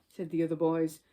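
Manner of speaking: plain reading voice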